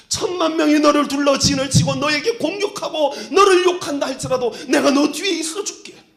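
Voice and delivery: loud, high, swelling promise; fervent promise. Retold or imitated. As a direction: build it to a passionate cry. God's promise rising to a shout. (열정적으로 외치는 하나님의 약속)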